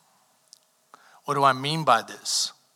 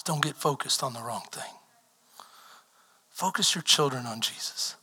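hushed plea; 5 dB quieter than usual. Acted. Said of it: "soft pleading repetition".

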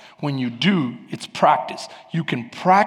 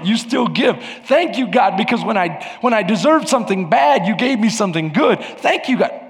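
building, raised-pitch prayer of thanks; fervent thanksgiving prayer; 5 semitones higher, 5 dB louder than usual. Shown as a demonstration. Preacher showing how to bathe need in thanksgiving, praying fervently. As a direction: pour out thanks, punching each "thank you".

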